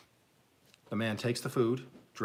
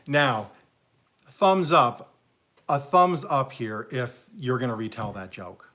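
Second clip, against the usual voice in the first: loud call-out; 3 semitones higher, 8 dB louder, slower than usual. Real, not acted. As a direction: shout it to the viewers. Calling out to viewers.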